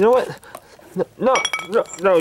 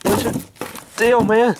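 usual speaking voice

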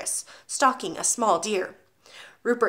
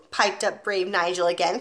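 plain reading voice

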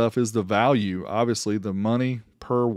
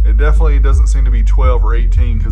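loud, raised voice over booming bass; talking over loud music; 15 dB louder than usual. Real, not acted. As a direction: raise your voice above the bass so they hear you.

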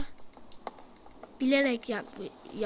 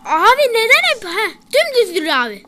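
panicked shout; 6 semitones higher, 9 dB louder than usual. Real, not acted. Panicked gaming shout at teammate.

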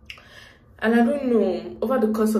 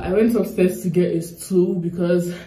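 normal talking voice

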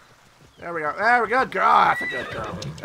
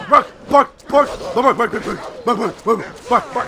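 loud higher bark-bark chant; mock barking; 3 semitones higher, 9 dB louder, slower than usual. Player barks at the wolves to lure them.

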